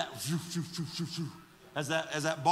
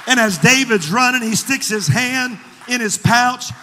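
loud, raised sermon storytelling; full-voice preaching; 4 semitones higher, 11 dB louder than usual. Real, not acted. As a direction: drive the story at the congregation, easing slightly.

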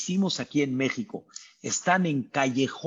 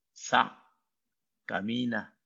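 ordinary talk, nothing marked

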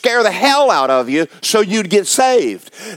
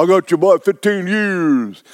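put-on older man. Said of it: "when I'm talking to older men".

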